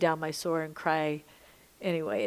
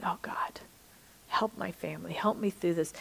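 a soft pleading whisper to God; whispered prayer; 5 dB quieter than usual. Retold or imitated. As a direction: pray it under your breath, half-broken. Retold desperate prayer in grief.

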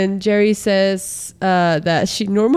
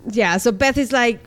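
usual speaking voice

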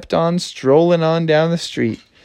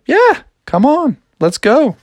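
Froggy voice; 11 semitones higher than usual. Acted. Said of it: "he's like".

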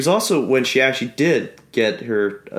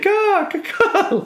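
bird caw; much slower than usual. Retold or imitated. Imitating the bird sound.

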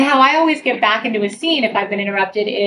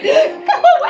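mock whiny go away; 4 dB louder, slower than usual. Partner under covers, shooing her.

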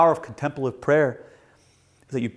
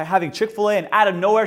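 usual speaking voice

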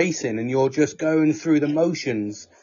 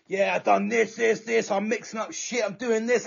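higher, chirpy mocking impression of a busy friend; mock Nelly; 7 semitones higher, 5 dB quieter than usual. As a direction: tease him by parroting his bragging, pitched up. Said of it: "Nelly, you're like".

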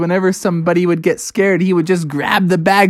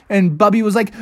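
ordinary delivery

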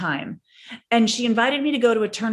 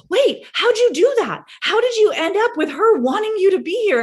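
high, loud astonished question; mock the moms' wide-eyed disbelief; amazed moms; 9 semitones higher, 5 dB louder than usual. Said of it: "four other moms sitting around just going".

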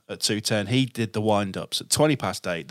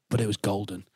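usual speaking voice

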